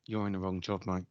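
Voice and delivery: low, quiet, unimpressed verdict; mock disapproving remark. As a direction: imitate a workmate's muted disapproval. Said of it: "for good and for bad".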